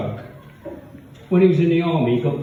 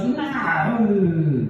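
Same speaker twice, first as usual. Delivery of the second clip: put-on old lady voice; 6 semitones higher than usual. Old woman refuses.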